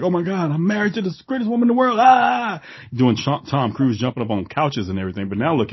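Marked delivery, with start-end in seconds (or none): 0.0-2.6 high, fast mock rapture; over-the-top gushing. Mocking husbands who gush over wives, high, fast and hammed-up. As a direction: gush like a man jumping on couches. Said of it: "blow a gasket out like".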